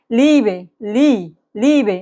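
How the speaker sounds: লিবে with a drawn-out "ee"; long i. Shown as a demonstration. Teacher models German word for learners, long "ee" in লিবে. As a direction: stretch the first vowel of লিবে slowly. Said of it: "দীর্ঘির মতো ই".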